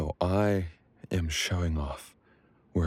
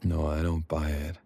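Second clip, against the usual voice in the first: normal talking voice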